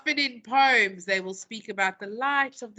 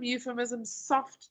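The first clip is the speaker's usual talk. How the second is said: usual speaking voice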